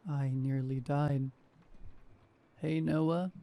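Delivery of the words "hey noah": a lightly voiced croak of a sardonic radio DJ; mild eerie radio croak greeting. A narrator's reading. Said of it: "the voice croaked".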